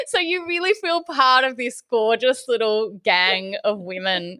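ordinary talk, nothing marked

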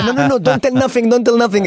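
high, insistent re-enacted outburst; excited on stage. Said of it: "I get excited on stage".

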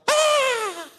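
high, loud mock shriek with a laugh; mock scream. Preacher imitating screaming neighbours, high mock shriek. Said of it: "They're screaming".

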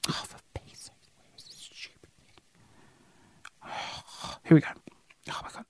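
barely audible grumbling whisper; whispered exasperation. Real, not acted. Podcaster can't find the song to play, whispered exasperation. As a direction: grumble under your breath, almost no voice.

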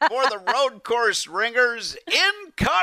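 high, hammed-up incoming call; mock warning cry. Radio host sends the road course ringers to be blown up, mock warning cry. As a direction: call out a pretend bombardment, pitch soaring.

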